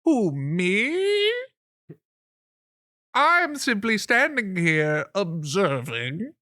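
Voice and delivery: high, drawn-out, theatrical creature voice; oily, hammed-up "who, me" sidekick; frog-like aide. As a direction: protest innocence with swooping high pitch. Ribbit aide feigns innocence when addressed.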